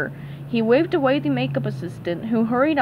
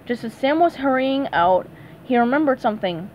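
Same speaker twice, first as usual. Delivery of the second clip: plain reading voice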